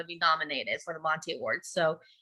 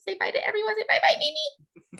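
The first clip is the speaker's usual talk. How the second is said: much higher coaxing voice for a little one. Real, not acted. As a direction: coax Mimi to say bye to everyone. Coaxing little Mimi to wave.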